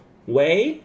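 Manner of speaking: 喂 said with a rising second tone, wéi; questioning wéi on the phone. Shown as a demonstration. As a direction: lift 喂 upward like a question. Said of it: "the second tone".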